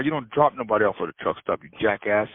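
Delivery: ordinary delivery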